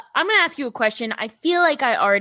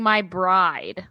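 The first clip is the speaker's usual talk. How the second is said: imitating deep voice; 6 semitones lower than usual. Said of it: "I don't like how he kept saying".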